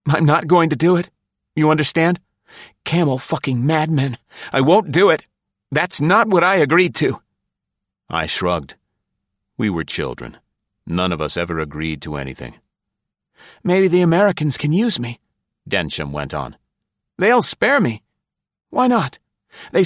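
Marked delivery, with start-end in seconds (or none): 0.1-7.2 high, fast, frantic defiance; cornered man shouting he won't do it; panicked refusal. A narrator's reading. 13.6-15.2 put-on high, tense voice of a paranoid old spy; nervous conspirator pitched high; Densham's voice. A narrator's reading. Densham hopeful.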